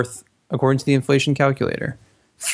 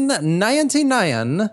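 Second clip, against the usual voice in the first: announcer voice; 10 semitones higher, slower than usual. Acted. Echoing the mock radio ad.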